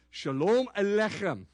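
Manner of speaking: "Shalom Aleichem" with a strong throaty ch in Aleichem; correcting a California accent with the Hebrew ch; proper Hebrew ch. Shown as a demonstration. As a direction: rasp the ch of "Aleichem" from the back of the throat. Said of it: "Very, very good diction".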